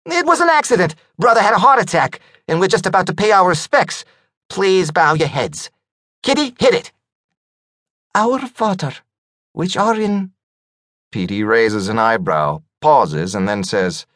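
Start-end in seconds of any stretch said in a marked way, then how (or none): 0.1-6.9 high, fast nervous surfer dude. Acted. Skunky talks fast, excuses. 8.1-10.3 prayer. Pretend. Mock prayer at funeral.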